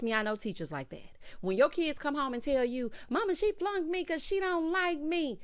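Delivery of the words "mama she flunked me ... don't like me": put-on higher, whiny child complaining; lightly voiced kid. A child complaining to mama about a teacher, higher and whiny. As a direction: play a kid telling on the teacher.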